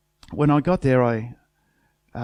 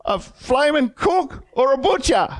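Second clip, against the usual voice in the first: much higher, scornful mimicry of a rude cook; mock indignant worker; 11 semitones higher than usual. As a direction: sneer it back at him in a high, put-on voice. Continuing the mocked rude reply.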